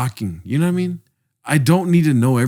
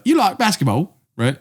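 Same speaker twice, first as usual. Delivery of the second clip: put-on botched Australian accent, higher-pitched; 5 semitones higher than usual. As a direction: mimic an Australian, landing nearer British. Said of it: "that's, like, British. I don't know how to do an Australian accent".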